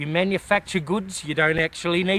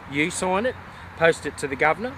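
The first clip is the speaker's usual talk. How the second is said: ordinary delivery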